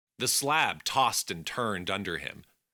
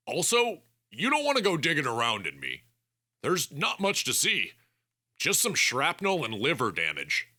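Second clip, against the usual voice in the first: quick, higher, nervous bargaining; higher young man voice; 7 semitones higher than usual. Acted. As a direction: talk fast to save yourself. Bennett keeps bargaining with the doctor.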